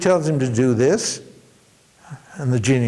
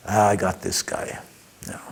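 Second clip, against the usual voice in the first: lower, softer sly gloat; gloating genie; 6 semitones lower, 6 dB quieter than usual. Acted. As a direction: savour the trap like a predator. Genie gloating over his victim.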